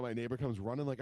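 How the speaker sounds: ordinary delivery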